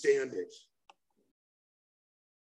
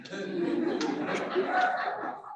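breathy incredulous laugh; 11 semitones higher, 6 dB quieter than usual. Real, not acted. Laughing at the s'mores scene.

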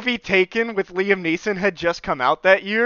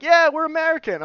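high, louder mock group answer; eager reply; 12 semitones higher, 5 dB louder than usual. Americans answering a lost traveler, eager reply. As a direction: pipe up high as a friendly stranger. Voicing strangers answering his question.

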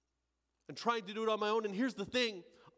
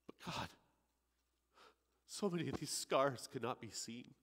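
low, soft, hesitant admission; quiet confession; 6 semitones lower, 5 dB quieter than usual. Acted. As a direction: confess it softly, almost ashamed. Thief confessing to God.

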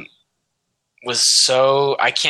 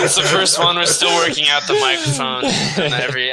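laughing while talking; 6 semitones higher, 3 dB louder than usual. Real, not acted. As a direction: still laughing, words a bit higher. Still laughing after dinner call.

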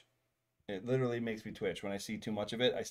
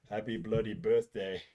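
lightly put-on British accent, lower pitch; 4 semitones lower than usual. Man tests a listener's birthday line, in a light British accent. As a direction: try out a hint of British. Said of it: "bloody birthday in a British accent".

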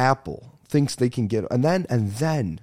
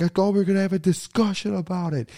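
much higher, whiny mock plea; imitating pleading boyfriend; 10 semitones higher than usual. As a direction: play the guy begging to talk it over. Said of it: "the guy's like".

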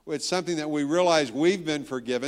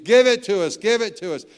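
louder, higher, impatient plea to hurry up; mock eager begging; 6 semitones higher, 7 dB louder than usual. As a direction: beg like a crowd on the edge of its seats. Audience begging the speaker to finish.

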